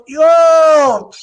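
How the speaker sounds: loud, high "Yo!" yelled; mock shout. Imagined woman answering the phone, shouting loud. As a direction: yell back threateningly. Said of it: "she answers".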